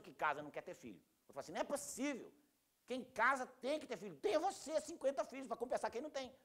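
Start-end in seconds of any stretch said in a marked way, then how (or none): 1.5-6.3 high, hammed-up indignation; exasperated, played-up objection, pitched very high; indignant outburst. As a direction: protest in mock outrage, pitch climbing. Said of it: "Deixa a pessoa indignada".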